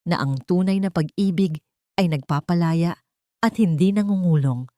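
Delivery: plain reading voice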